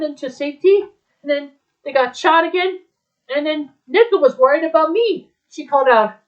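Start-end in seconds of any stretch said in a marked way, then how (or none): none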